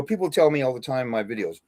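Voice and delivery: ordinary talk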